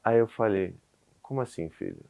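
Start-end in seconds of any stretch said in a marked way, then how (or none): 1.2-2.0 low and hushed, taken aback; wary, subdued question. Retold or imitated. Dad answering his hurt son, quietly uneasy. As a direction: ask it very softly, bracing yourself. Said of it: "você já fica assim".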